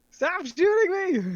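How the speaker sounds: high, loud protest; panicked shout. Real, not acted. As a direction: yell out in mock panic. Being shot in game.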